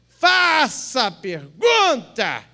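loud, very slow mock command; exaggerated priestly reply. Priest grants the woman her question, loud drawn-out mock patience. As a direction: stretch each word out loudly, hammed-up.

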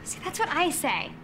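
usual speaking voice

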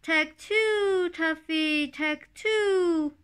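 high, loud sing-song bird call; the turtle dove's high, loud, silly call; silly dove cry. A narrator's reading. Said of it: "the turtle dove cried".

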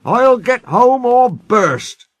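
high, loud, slow determination; emphatic, straining resolve; brave promise. Acted. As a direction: vow it with all your might. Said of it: "he promised bravely".

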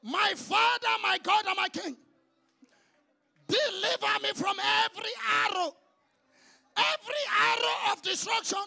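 fervent shouted prayer with long held Oh Lords; a pastor leading the congregation in loud prayer; preaching prayer. Acted. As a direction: cry out each plea to God.

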